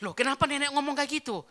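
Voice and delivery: high, loud surprised question; acted shock at granny's words; startled. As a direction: blurt it out, taken aback. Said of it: "Kaget saya".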